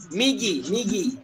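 very slow model word; slow demo. Shown as a demonstration. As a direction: draw migi out slowly. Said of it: "tak ada masalah".

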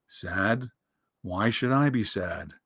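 low, soft gloomy donkey; sad Eeyore. A narrator's reading. Donkey asked why he seems sad, gloomy. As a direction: deny it in a low, mournful voice. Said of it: "You seem so sad".

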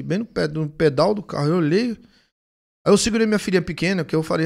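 usual speaking voice

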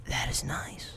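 dry sarcastic whisper. Real, not acted. Gamer chased by the bear, sarcastic whisper. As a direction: whisper it deadpan while fleeing.

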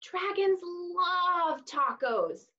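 ordinary delivery

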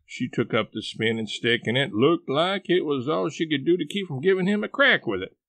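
ordinary delivery, nothing marked

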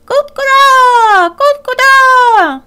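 high, loud, slow crowing; rooster call. Acted. Rooster calls out meeting the hare, high and loud. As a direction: crow out like a proud rooster.

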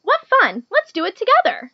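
high, loud, gleeful invitation; happy pollywog. A narrator's reading. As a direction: cheer it like a delighted little pollywog. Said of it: "the pollywog said happily".

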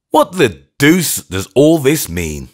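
loud, slow near scream; almost scream it out. A narrator's reading. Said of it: "I almost screamed".

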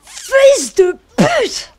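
high screaming insults; furious scream. Real, not acted. Screaming at game enemy. (hurlement furieux)

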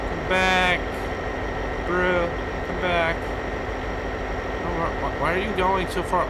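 high, loud pleading call; loud plea across the snow. Real, not acted. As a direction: shout after him to come back. Calling a fleeing skier back.

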